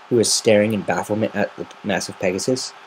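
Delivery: usual speaking voice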